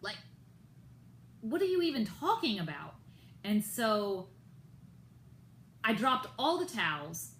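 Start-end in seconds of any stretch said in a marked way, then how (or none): 0.0-2.9 exasperated reply. Retold or imitated. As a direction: act baffled at a silly accusation. Retelling her own baffled reply.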